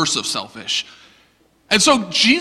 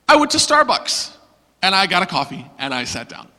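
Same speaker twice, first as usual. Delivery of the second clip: ordinary talk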